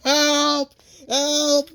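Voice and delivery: high, loud panicked cries for help; crying for help. Retold or imitated. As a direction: shout for help as he speeds away.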